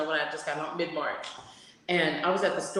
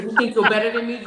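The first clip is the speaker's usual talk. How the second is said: angry confrontation; 4 semitones higher, 8 dB louder, faster than usual. Retold or imitated. Woman confronting her.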